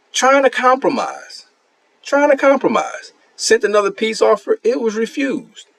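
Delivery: high, sing-song outrage; mock indignation. Real, not acted. Streamer mocking the refused peace offer, high and indignant. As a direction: play up the injustice to viewers.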